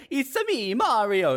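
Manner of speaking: high, sing-song cartoon Italian accent; stagey Italian accent impression; Mario. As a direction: do a hammed-up Italian plumber. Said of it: "when people do the Italian accent".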